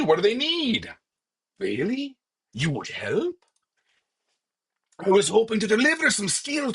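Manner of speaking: high, theatrical quest giver; lilting high NPC reading; hammed-up NPC voice. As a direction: overplay a fussy tavern patron. Voices player and NPC dialogue.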